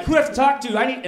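high, loud mock outrage; indignant demand. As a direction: demand it like a fed-up customer. Retold demand at the counter.

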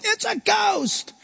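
high, slow panicked cry of the disciples; mock terrified shout; frightened shout. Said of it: "That's why they shouted".

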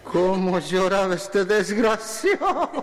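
high, drawn-out comic lament; mock wail. Comedian describing the santiagueño's crying, mock wailing. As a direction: stretch it into a hammed-up wail.

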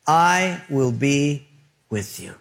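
God. Acted. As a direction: deliver it as a calm, unhurried promise. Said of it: "And God said".

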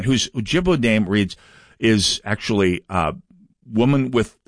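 ordinary delivery

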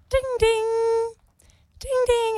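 doorbell imitation.

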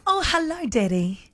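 lightly voiced nana saying hello; imitated nana greeting. Host imagines the nana teacher turning round, lightly voiced. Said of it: "turns around to you and goes".

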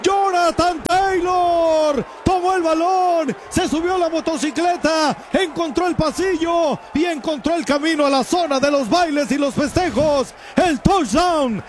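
loud play-by-play rising to a scream; touchdown call. Real, not acted. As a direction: build the run, then shout the touchdown high. Live play-by-play of touchdown run.

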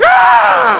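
very high, loud yelp of pain; mock scream. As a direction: scream like hot soup hit you. Soup on the neck.

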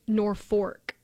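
Norfolk as NOR-fork, slow; Nebraska pronunciation. Shown as a demonstration. Telling of the Nebraska town, Norfolk said NOR-fork. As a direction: turn the L of Norfolk into an R. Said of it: "they pronounce the L as an R".

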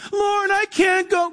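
high, drawn-out wail of reluctance; mock-pleading, stretched-out excuse; protesting Moses. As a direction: plead your way out of it, slow and high.

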